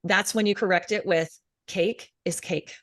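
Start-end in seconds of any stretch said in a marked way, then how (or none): 1.6-2.8 flat, slow robotic mantra. Shown as a demonstration. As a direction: state it like a machine, no feeling. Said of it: "like, robotic".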